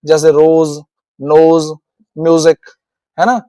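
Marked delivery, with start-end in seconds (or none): 0.3-2.6 रोज, नोज, म्यूजिक with S voiced as a z/j sound. Shown as a demonstration. Teacher showing S between vowels, voiced ज in रोज, नोज, म्यूजिक. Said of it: "ज भी बोला जाता है".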